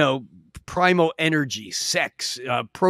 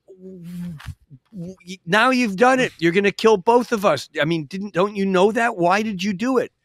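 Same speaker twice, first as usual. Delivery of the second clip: high, exasperated reproach; acted-out dismay, pitched up; dismayed frog; 4 semitones higher than usual. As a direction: protest in disbelief as the doomed frog. Stung frog reproaches the scorpion.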